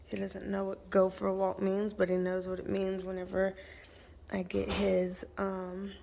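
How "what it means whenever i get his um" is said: unvoiced hush so the dog won't catch on; whisper. Real, not acted. Owner whispering so the dog won't hear the leash. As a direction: breathe the words out without voice.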